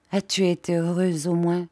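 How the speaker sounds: ordinary delivery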